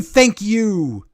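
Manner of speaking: milder echo of a wrestler's catchphrase; imitated catchphrase. Insisting the catchphrase existed, imitating it. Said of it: "used to say, 'Thank you.'".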